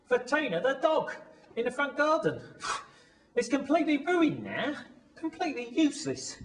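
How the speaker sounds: high-pitched indignation; exasperated, pitch held up high; aggrieved complaint. Acted. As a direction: moan about it, wounded and indignant. Aggrieved over ruined lucky riding crop.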